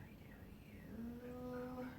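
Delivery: slow, breathless whisper of recognition; awed whisper. Retold or imitated. Recognizing the figure at her bedside, in a slow whisper. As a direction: hush each word out slowly like a secret.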